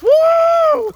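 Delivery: very high, loud, drawn-out whoop; piercing mock audience cheer; imitated crowd "woo". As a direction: whoop like a guy at the back of the club. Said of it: "some random dude in the back".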